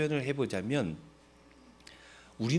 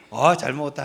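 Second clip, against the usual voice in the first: satisfied sigh; 8 dB louder than usual. Retold or imitated. Satisfied diner after gukbap.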